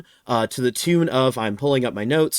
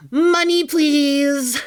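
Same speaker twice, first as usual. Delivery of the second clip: high pleading squeak for money; squeaky begging voice; 12 semitones higher, 3 dB louder, much slower than usual. Acted. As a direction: beg in a tiny high voice.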